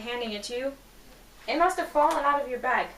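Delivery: ordinary delivery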